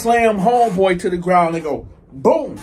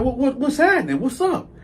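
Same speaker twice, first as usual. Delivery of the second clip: higher-pitched retold challenge; mildly agitated, high-pitched comeback; defensive challenge; 7 semitones higher than usual. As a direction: confront them, pitch jumping up.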